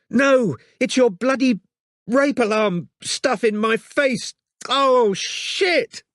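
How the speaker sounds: high slow pained woman's voice; distressed woman. Acted. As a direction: wince through the sting. Robin hurt and distressed.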